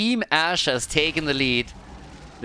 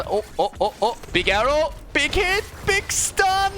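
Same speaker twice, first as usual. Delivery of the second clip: excited; 11 semitones higher than usual. Real, not acted. Play-by-play of big combo.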